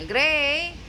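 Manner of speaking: loud calling to wake the pet. Real, not acted. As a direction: shout the name to wake him up. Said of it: "Sumisigaw na ako".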